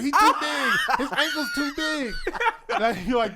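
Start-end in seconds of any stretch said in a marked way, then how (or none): 0.0-2.1 high, loud mock shouting; retold yelling on a friend's behalf; yelled protest. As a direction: holler it over the laughter. Friends yelling at cop for him.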